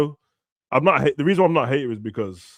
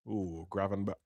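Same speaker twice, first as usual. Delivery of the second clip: ordinary delivery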